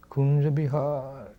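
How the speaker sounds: ecstatic cry. Retold or imitated. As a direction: let the name trail out slowly in longing. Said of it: "like this".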